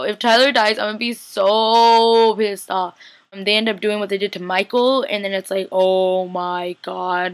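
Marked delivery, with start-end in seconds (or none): 0.8-3.0 "so" stretched long and loud; loud, drawn-out stress on "so"; emphatic venting. Real, not acted. Vents about a possible death. 5.7-7.3 very slow, stretched exclamation; long, slow "oh my god" reaction; drawn-out dismay. Retold or imitated. Retells shock at the scene.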